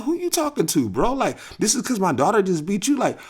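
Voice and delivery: high, loud indignant challenge; heated retort; angry confrontation. Retold or imitated. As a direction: square up to the man. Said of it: "I'm like".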